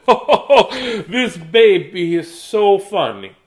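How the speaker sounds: high, loud laughing delight; laughing grandparents. Acted. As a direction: laugh through the words like jolly grandparents. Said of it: "They said".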